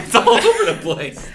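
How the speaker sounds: high laughing exclamation. Real, not acted. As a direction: shriek it while laughing. Drink spat, laughing hard.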